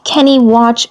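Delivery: plain reading voice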